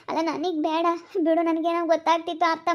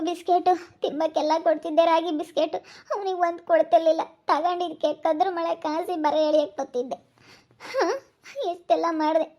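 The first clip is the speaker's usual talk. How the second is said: ordinary talk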